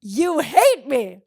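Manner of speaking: slow, loud, drawn-out accusing; dramatic mock accusation. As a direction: drag it out in mock outrage.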